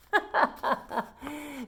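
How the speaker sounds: short amused laugh at the joke. Real, not acted. Reader laughing at the string beans joke.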